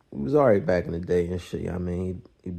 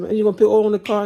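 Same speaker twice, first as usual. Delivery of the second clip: much higher, louder and fast, disbelieving; incredulous yell; 13 semitones higher, 9 dB louder, faster than usual. Retold or imitated. Questioning Hammer about the oil, fast incredulous yell. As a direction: shout it in disbelief.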